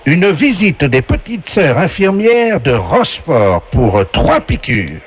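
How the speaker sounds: slow, booming quiz-show host; game show announcer voice. Acted. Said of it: "la voix du jeu".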